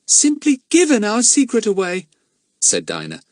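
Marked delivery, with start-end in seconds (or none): 0.1-2.1 put-on high, loud girl's voice, outraged; indignant girl. A narrator's reading. Dinah finding her voice first, high and indignant. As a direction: Dinah bursting out, scandalised.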